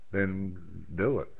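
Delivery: ordinary talk, nothing marked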